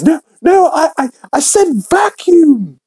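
squealed high-pitched protest; mock-frantic denial, far above normal pitch; Stewie. Stewie covering up his insult to Brian, high protest.

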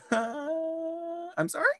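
high, drawn-out disbelieving I'm sorry; incredulous. Real, not acted. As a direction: act like you can't believe it. Incredulous reaction.

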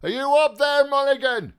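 high, loud hail held on one pitch; loud call. A narrator's reading. Voice inside the tower hailing Mulligan, calling loudly. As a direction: call up to someone out of sight. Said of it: "A voice within the tower called loudly".

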